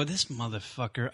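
muttered curse. Retold or imitated. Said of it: "I pull up behind and go".

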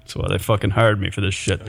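dropping low and quiet at the end; trailing off. Real, not acted. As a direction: let it fade out under your breath. Joking aside trailing off quietly.

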